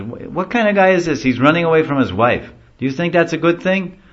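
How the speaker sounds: high, loud taunting jester; mocking charioteer. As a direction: goad the crowd, showman style. Charioteer teasing the crowd.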